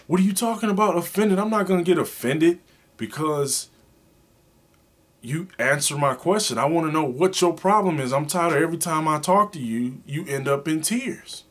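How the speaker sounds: exasperated husband demanding an answer; indignant insistence. Retold or imitated. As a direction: insist you won't be offended, pressing hard. Husband insisting wife explain problem.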